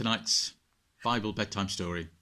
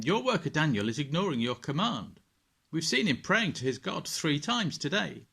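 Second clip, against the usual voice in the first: tattling accusers; 7 semitones higher, 5 dB louder than usual. Acted. Men tattling to the king.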